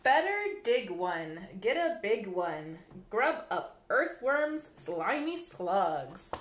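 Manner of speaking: lilting, sing-song storybook reading; bouncy picture-book read-aloud; reading to children. Acted. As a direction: read the rhymes to little ones at storytime.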